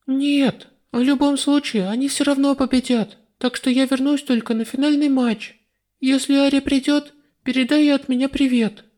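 put-on high, breezy girl voice; young goddess voice. A narrator's reading. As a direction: answer lightly, already leaving. Lista answers Celsey.

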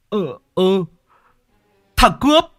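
very high, very loud stunned yell; shocked shouting. A narrator's reading. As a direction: scream after the stolen bike. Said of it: "giật mình há hốc cả mồm". (Lâm Sẹo hét gọi kẻ cướp xe)

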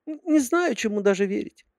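put-on high girly secretary voice; sweet, high-pitched young secretary; cartoon princess. A narrator's reading.

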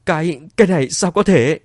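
high, loud, stammered question; stunned disbelief. A narrator's reading. Wife learns who owns everything, stunned disbelief. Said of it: "vẻ mặt Chu Kim Hảo liền ngơ ngác".

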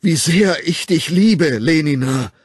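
slow, high, loud cry of love; anguished shouted confession; desperate outburst. A narrator's reading. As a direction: let it burst out in despair. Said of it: "brach es geradezu verzweifelt aus ihm hervor".

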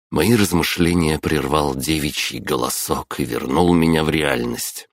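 ordinary delivery

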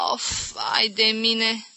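slow, high, tearful moan; drawn-out whimper of pain. Acted. Aunt carefully moving her sore arm, pained moan. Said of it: "strâmbându-se și mișcând precaut brațul".

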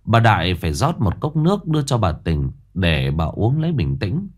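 ordinary delivery

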